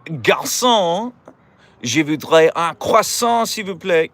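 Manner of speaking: high, hammed-up French accent; mock French. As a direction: call the waiter in over-the-top French. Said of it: "go up to someone and be like".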